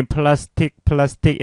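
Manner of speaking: 플라스틱 in Korean syllables, every vowel sounded; Korean-style pronunciation. Shown as a demonstration. Teacher showing learners the habit to drop, 플라스틱 with Korean vowels. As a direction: say 플라스틱 as a Korean loanword, not English plastic. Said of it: "이렇게 발음하잖아요".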